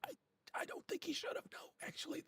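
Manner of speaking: high, hissy little whisper; whispered reply. Acted. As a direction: whisper back as a tiny companion. Whispered reply from shoulder companion.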